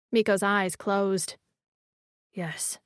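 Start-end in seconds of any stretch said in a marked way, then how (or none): 2.3-2.8 hushed, barely there whisper. A narrator's reading. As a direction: whisper it softly. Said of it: "he whispered".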